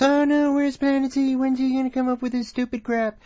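mocking the listeners. Host mimicking listeners asking for Planet Z, high mocking whine. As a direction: whine it like impatient fans. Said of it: "where everyone says".

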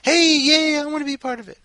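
high, loud, gushing mock cheer; mock eager crowd. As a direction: squeal it like an overeager fan. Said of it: "All these people just like".

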